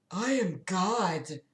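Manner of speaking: soft, slow godly proclamation; solemn. Acted. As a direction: quietly declare it, drawing it out. Cloaked man reveals himself as God.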